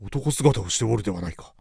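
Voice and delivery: put-on quiet, low young lord; a hint of a puzzled nobleman. Tadanori voiced, far timbre.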